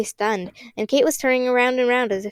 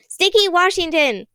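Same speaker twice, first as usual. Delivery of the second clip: shouting a name; 8 semitones higher, 4 dB louder than usual. Acted. Constance shouts a name to resist the machine, high and loud. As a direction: bellow the name out with effort. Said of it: "Constance shouted".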